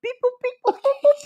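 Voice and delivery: high beeping computer noises; robot beeps. Pretend. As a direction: beep like a little computer.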